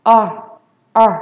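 isolated R with curled tongue. Shown as a demonstration. Teacher demonstrating the sound to students, curled R. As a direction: curl the tongue up for "R". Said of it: "Cong lưỡi lên và đọc thành R".

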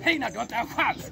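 normal talking voice

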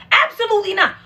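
high, loud refusal; outraged refusal. Real, not acted. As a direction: shriek it off in disbelief. Reacting to own failed attempt.

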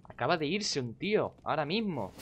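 high-pitched, swooping disbelief; astonished outburst, falling at the end; incredulous exclamation. Real, not acted. Indignant repeat on realising it.

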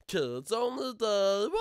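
loud, high, drawn-out wail; slow high-pitched mock bawl. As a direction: stretch it out into a loud cry.

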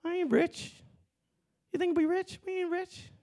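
high, soft squeaky denial; playful high-pitched denial; mock squeak. Real, not acted. Pastor denies wealth.

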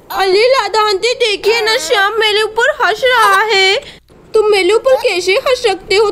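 put-on high childish voice, hurt and cross; a little girl stung by being laughed at; sulky little girl. Sonam sulking at Shyam for laughing at her, high and childish.

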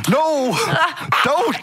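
high-pitched acted protest; horrified cry. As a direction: cry out helplessly as someone is taken. Acted scene, crying out at abduction.

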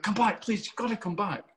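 high-pitched imitated plea; urgent pleading. Young man begs the actor to return to the room, imitated pleading.

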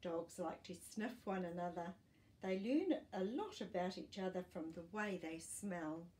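normal talking voice

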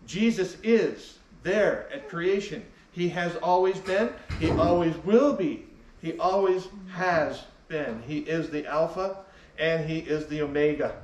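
ordinary talk

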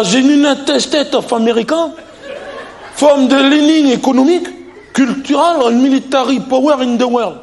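put-on high-pitched reading in heavy French-accented English. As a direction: read English aloud like a French pupil in an oral. Candidate reads English aloud in exam.

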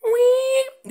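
high squealing door-hinge noise; imitated squeaky door, very high and loud; squeaky car door. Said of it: "a squeaky car door".

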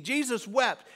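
ordinary delivery

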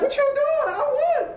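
high-pitched retort, imitating her; retold indignant woman. Card cheat challenges the winner, high mock protest. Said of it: "She's like".

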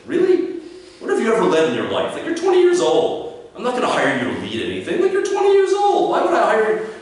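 high, loud, mock-outraged disbelief; incredulous challenge. Employer dismissing a twenty-year-old's leadership boast, incredulous. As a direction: pitch jumping way up in scornful disbelief.

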